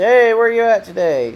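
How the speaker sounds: lightly voiced grandfather; grandpa's greeting. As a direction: mimic grandpa's cheerful daily call. Grandpa's daily call retold.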